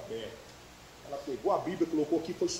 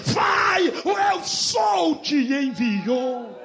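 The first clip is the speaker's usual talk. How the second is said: high, sustained shouting in rhythmic refrains; preaching at full force; 9 semitones higher, 8 dB louder, slower than usual. Real, not acted. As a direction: build from drawn-out declarations to a relentless shouted climax. (pregação gritada a plena força, em refrões)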